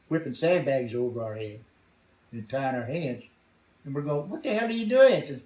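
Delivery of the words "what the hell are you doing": much higher, louder outraged question; indignant protest. Retold or imitated. Prisoners being bagged and tied challenge their rescuers, indignant protest. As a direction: protest it with the pitch jumping up.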